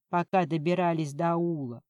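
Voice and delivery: usual speaking voice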